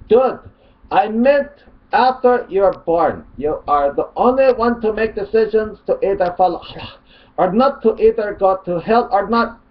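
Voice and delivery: high, loud put-on Arabic accent; mock Arabic accent. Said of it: "Mock Arabic accent here".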